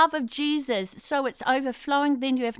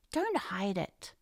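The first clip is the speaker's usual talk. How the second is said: low and soft; soft, slow urging; 4 semitones lower, 8 dB quieter, slower than usual. Acted. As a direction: coax it gently. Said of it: "repeated urging".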